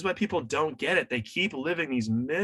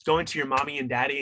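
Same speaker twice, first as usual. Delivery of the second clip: ordinary delivery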